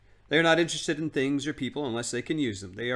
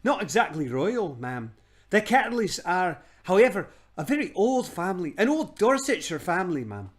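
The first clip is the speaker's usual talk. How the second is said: put-on flustered old man; 6 semitones higher, 4 dB louder than usual. Uncle Andrew flustered.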